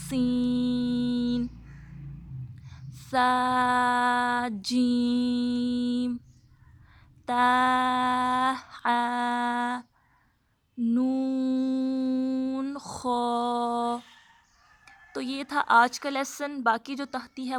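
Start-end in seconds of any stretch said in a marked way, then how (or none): 0.1-1.5 سین with a sharp whistling s, drawn out slowly; whistled seen. Shown as a demonstration. Teacher showing students the whistling seen, slow and sharp. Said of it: "سین کو سیٹی کی آواز سے پڑھنا ہے". 3.1-14.0 slow, careful letter recitation; alphabet drill. Shown as a demonstration. Teacher reciting letters for students, slow and clear.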